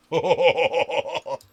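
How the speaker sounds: big loud laugh; loud burst of laughter. Real, not acted. Player laughing at his bad luck, loud laugh. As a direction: crack up out loud.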